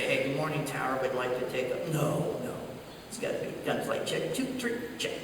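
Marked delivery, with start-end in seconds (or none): 0.0-1.9 low, soft mock pilot chat; wrong radio voice. Fighter pilot greeting the tower the forbidden way, low and soft. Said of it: "You, you can't, you know, say". 4.0-5.1 soft, cool fighter pilot check-in. Retold or imitated. Fighter pilot checking in on frequency, very cool. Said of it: "It's gotta be very cool".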